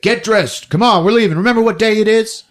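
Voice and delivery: lightly voiced dad rushing the boy out; hurried dad. Dad hurrying his son out the door, lightly voiced. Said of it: "He's like".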